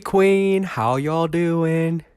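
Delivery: high, drawn-out fake Southern American greeting; a hammed-up Southern American hello; butchered Southern American accent. Greeting viewers in a mock Southern American accent. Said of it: "butchered Southern American accent".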